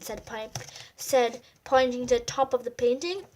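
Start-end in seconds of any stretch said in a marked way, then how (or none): none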